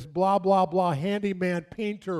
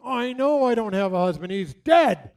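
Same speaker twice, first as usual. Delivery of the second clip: louder, higher mock outburst; retold exasperated anger; 4 semitones higher, 6 dB louder than usual. Pastor imitating widow mad at her late husband, loud exasperation. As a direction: imitate a widow snapping back. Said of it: "she's mad".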